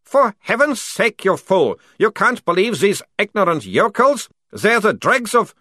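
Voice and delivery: loud, high-pitched outrage; indignant outburst. Acted. Captured captain berating a lieutenant, indignant outburst. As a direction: berate him as a fool.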